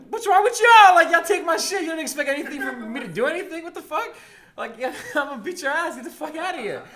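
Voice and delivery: high-pitched indignant outburst, loud at the start; angry retort. Retold or imitated. Storyteller replaying his comeback to everyone, indignant.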